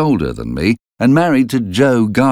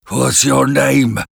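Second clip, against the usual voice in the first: higher, slow, demanding convict voice; menacing convict; 6 semitones higher, slower than usual. A narrator's reading. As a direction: interrogate the boy menacingly.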